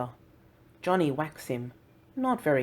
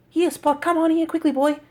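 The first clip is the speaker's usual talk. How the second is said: eager calling; 13 semitones higher, 9 dB louder than usual. Acted. Contrasted eager calling example.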